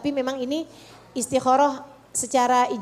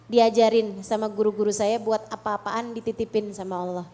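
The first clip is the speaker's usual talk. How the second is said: usual speaking voice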